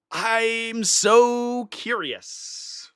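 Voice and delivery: very slow, stretched out; savoring the thought slowly; drawn-out wonder. Real, not acted. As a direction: draw each word out, musing. Repeats the line twice.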